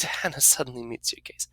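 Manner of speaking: usual speaking voice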